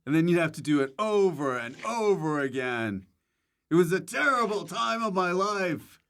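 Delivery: high, hammed-up lament; mock anguish. Actor jokes to host about endless recording, mock anguish. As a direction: overplay the misery as a joke.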